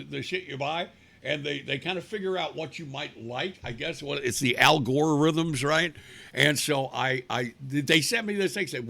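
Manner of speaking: usual speaking voice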